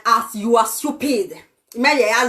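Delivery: ordinary delivery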